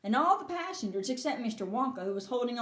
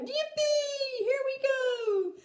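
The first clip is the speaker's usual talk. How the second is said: high, slow whooping cheer; an old man loving the drop; gleeful high yippee; joyful shout; 12 semitones higher, much slower than usual. Acted. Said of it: "Grandpa Joe shouted".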